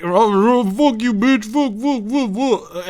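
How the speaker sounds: put-on ranting thug voice; imitating a guy losing it, cursing; mock rage. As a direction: spit the curses in a hammed-up tantrum. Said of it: "he flies off into a fucking rage".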